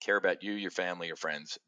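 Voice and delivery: said again softly, as sincere advice; quiet repeat. Shown as a demonstration. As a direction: drop it down and let it land. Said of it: "I'll say it again".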